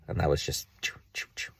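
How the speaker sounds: soft unvoiced choo choo of a train; whispered train sound. Man showing money passing through, whispering choo choo.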